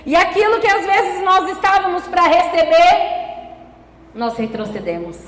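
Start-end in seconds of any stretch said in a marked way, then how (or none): none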